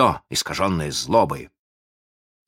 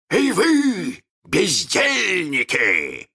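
протяжный высокий крик, властный окрик; кричи издалека, растягивая слова; капитан Крюк; 13 semitones higher, much slower than usual. A narrator's reading. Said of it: "закричал он голосом капитана Крюка". (командный крик капитана)

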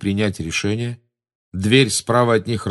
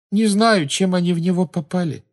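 put-on high, plaintive girl's voice; sad; 11 semitones higher than usual. A narrator's reading. Luna doesn't know what hit Ron, sad. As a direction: admit it sadly, pitched high. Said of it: "грустно сказала Полумна".

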